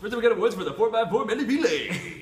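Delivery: big, high, loud morning radio host. Pretend. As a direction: boom it out like a morning DJ. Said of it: "my radio morning D J voice".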